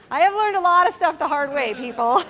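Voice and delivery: amused, laughing confession. Real, not acted. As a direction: laugh while admitting it. Laughs confessing her mistakes.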